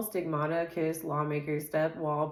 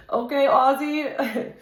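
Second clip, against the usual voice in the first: high, loud laughing okay; 7 semitones higher, 8 dB louder, slower than usual. Real, not acted. Reader laughing at Ozzy's spirit, high and loud. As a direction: laugh it out slowly.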